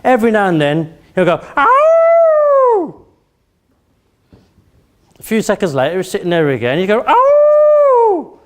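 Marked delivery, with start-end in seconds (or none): 1.6-3.0 long high-pitched howl; dog howl. Retold or imitated. Speaker imitating Bruno by the fire, long dog howl. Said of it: "but that's a dog howling". 7.1-8.4 sustained, very high howl; dog howl. Retold or imitated. Speaker imitating Bruno a few seconds later, dog howl. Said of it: "And that's how dogs howl".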